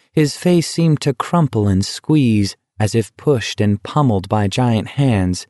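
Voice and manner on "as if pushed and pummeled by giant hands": plain reading voice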